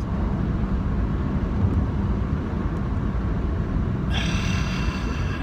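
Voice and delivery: a long, drawn-out audible breath out; long slow exhale. Shown as a demonstration. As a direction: breathe everything out. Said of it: "And then exhale".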